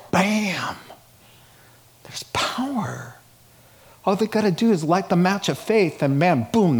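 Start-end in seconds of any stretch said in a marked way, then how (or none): 0.1-3.2 breathy, drawn-out near-whisper; hushed awe. Real, not acted. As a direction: let it out in a hushed, slow breath. Preacher marking the impact of scripture.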